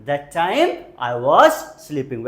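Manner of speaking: slow, clear teacher delivery; slow model sentence. Shown as a demonstration. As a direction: spell it out slowly for learners. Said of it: "Very good, कोशिश करते रहिए". (धीमा, साफ़ उच्चारण)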